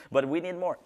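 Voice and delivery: ordinary talk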